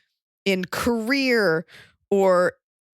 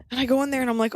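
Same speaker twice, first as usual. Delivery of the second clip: ordinary delivery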